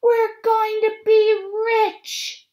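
very high, loud, drawn-out cry; joyful shout. Acted. Mother crying out to the family about the fortune, joyful shout. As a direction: throw your head back and whoop it. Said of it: "threw back her head and shouted".

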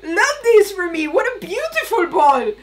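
sing-song parody of someone oblivious; mocking carefree Jack. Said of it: "and you're just like".

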